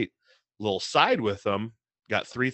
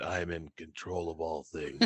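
low, soft, deadpan Batman; a quiet, lowered superhero growl; 5 semitones lower, 12 dB quieter than usual. Retold or imitated. Retelling the cyclist in a Batman mask's reply. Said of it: "looked at me in his mask and said".

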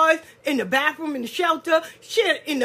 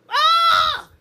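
loud, high "ah" of shock; mock scandalized scream; 6 dB louder than usual. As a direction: let out a high, theatrical shriek. Mock shock at church gossip.

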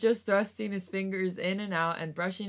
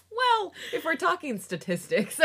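higher, louder mock-know-it-all quip; mock pedantic; 10 semitones higher, 6 dB louder than usual. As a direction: play the smug fact-checker, laughing. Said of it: "is that you're like".

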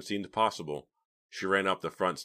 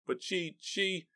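breaking into sobs; 13 semitones higher, slower than usual. A narrator's reading. Philip about to tell of his niece, sobbing. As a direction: choke up and break off. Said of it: "began to sob".

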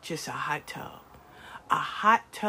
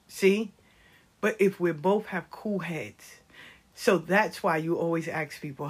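ordinary talk

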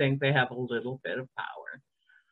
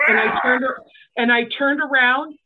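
raised voice, near crying; telling it louder and louder; loud, worked up; 6 semitones higher, 5 dB louder than usual. Real, not acted. Telling the podcast hosts how she stormed off, loud and worked up.

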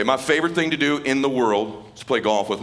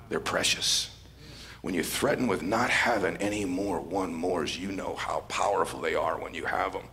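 soft, much lower, near-breathless; tender, hushed; 4 semitones lower, 8 dB quieter than usual. Retold or imitated. Dying father telling his son what matters, soft and tender. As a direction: hand him the lesson gently, barely above a whisper.

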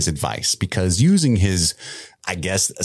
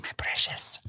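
whispered creepy Gollum rasp; 7 dB quieter than usual. Pretend. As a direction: hiss it like Gollum hoarding. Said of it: "What if Halbrand was just like".

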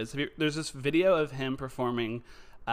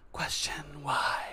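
breathy shout; 4 dB quieter, slower than usual. Acted. Podcast catchphrase whisper-shout.

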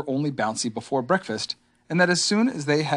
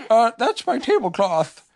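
high-pitched bear voice; Pooh; 10 semitones higher, 4 dB louder than usual. A narrator's reading. Pooh introduces himself to the stranger, high voice. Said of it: "said Pooh".

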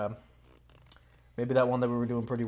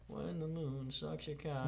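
put-on slow, soft French accent; quiet, drawn-out mock-French; 3 semitones higher, 13 dB quieter, slower than usual. Player giving a repeated clue, soft slow French accent. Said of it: "We got French already".

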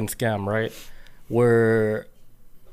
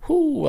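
high mock whew. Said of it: "I was like".

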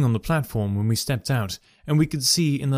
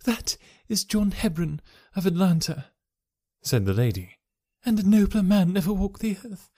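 a higher woman's voice, steady and dignified; proud wife; 6 semitones higher than usual. A narrator's reading. Wife telling of her late husband, higher and dignified. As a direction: speak of him with pride.

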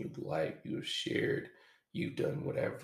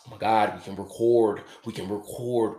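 louder and eager; excited; 9 dB louder than usual. Retold or imitated. Eager to record with his partner, excited. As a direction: blurt it out thrilled. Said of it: "I was like".

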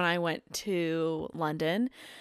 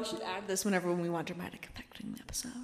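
whisper; 3 semitones lower, 11 dB quieter, faster than usual. Real, not acted. Whispered aside about echo.

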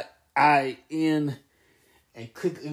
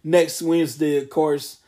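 normal talking voice

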